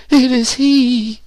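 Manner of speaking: very high, drawn-out cry of horror; slow falsetto shriek of recognition; horrified cry. A narrator's reading. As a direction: cry out in theatrical dread, high and loud. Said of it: "she cried, shuddering".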